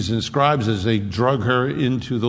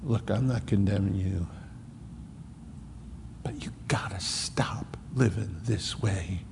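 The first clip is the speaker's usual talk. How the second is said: much softer, fading to a whisper; tender parent; 4 semitones lower, 10 dB quieter than usual. Retold or imitated. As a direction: plead gently with your own child. Parent gently confronting wayward child.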